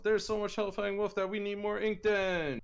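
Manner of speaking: high, loud hyped-up shout; excited hype. Real, not acted. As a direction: pump it up, big and loud. Excited hype over his handles.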